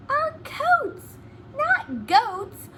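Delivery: high stress on "coats" and "not goats"; contrastive stress. Shown as a demonstration. Teacher to pupils, stressing coats against goats. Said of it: "goats and coats rhyme".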